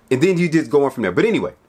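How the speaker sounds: ordinary talk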